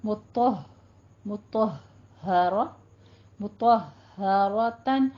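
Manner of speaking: "मुतहरतन" built up slowly, breaking on the first h; deliberate "मुतहरतन" with double h; firm doubled ha. Shown as a demonstration. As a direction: stop on the h of "मुतह-", then release a second ha into "मुतहरा". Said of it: "दो दफा हा को अदा करना है".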